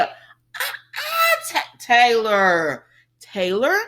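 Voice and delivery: high, slow, drawn-out uh-uh and Taylor; high exasperated scolding; scolding disbelief. Real, not acted. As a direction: call her out slowly.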